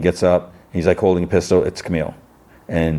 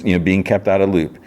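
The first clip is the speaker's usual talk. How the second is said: ordinary talk, nothing marked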